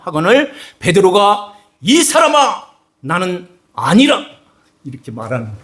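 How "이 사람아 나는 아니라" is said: high, sharp angry denial; harsh denial. Acted. Peter denying a third accuser, harsh. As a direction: shove the accusation away. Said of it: "더 심한 말로 말했을 거예요".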